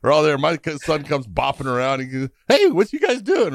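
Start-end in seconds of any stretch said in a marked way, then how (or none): 2.5-3.5 put-on much higher, innocent kid asking; little kid voice. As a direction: pitch way up like a curious young boy. Young son voiced in story.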